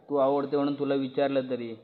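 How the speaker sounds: normal talking voice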